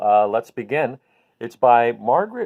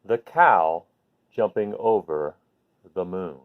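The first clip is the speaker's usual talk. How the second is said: put-on reading to a child; 3 semitones higher than usual. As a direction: read it gently like tucking a child in. Parent reading bedtime book aloud.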